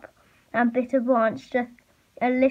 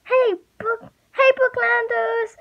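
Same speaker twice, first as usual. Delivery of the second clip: very high, sustained holler; shouting; 11 semitones higher, 3 dB louder than usual. A narrator's reading. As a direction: holler it out at the top of the range. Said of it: "she shouted".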